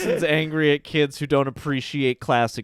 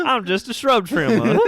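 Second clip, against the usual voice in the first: higher, louder Southern lament; hammed-up Southern accent; 8 semitones higher, 5 dB louder than usual. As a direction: play a sorry Southern landscaper. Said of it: "Real Rejects Southern Gothic".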